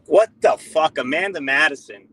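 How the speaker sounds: angry. Real, not acted. Angry caller rant.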